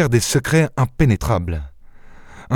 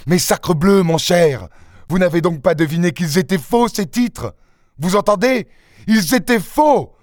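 higher, building to an outraged cry; rising anger; 6 semitones higher than usual. Acted. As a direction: build up until you explode. Lupin furious about fake bonds. (colère qui monte jusqu'au cri)

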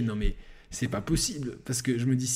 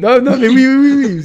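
much higher, much louder yes yes yes; excited shout; 10 semitones higher, 14 dB louder than usual. Real, not acted. Storyteller agreeing to act out the scene, excited shout. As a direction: burst out eagerly agreeing.

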